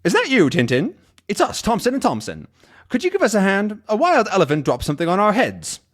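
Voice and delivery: high-pitched pleading character voice; hapless comic twins. Acted. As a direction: call out for help, comically high. Voices Thompson twins asking Tintin for help.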